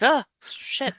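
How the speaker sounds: mildly startled, re-enacted curse; retold startle. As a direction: act out being caught by a jump scare. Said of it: "good jump scare 'cause it still got me".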